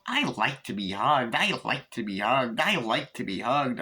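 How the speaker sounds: slow, sing-song toy voice on a loop; malfunctioning doll. Retold or imitated. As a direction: imitate a broken talking doll, looping mechanically. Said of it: "the doll starts malfunctioning".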